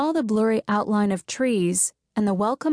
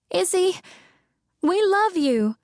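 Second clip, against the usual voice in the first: much higher, teary plea; tearful; 10 semitones higher than usual. Acted. Sky tearfully telling Izzy they love her. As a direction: say it close to tears. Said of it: "There were tears in Sky's voice".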